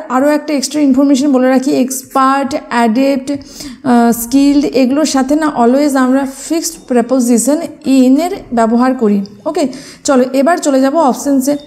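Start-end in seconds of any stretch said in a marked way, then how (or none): none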